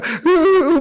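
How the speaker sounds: high mock whimpering sobs; imitated crying. Husband alone on the bed, imitated crying. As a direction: act out fresh tears welling up. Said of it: "또 눈물 나요".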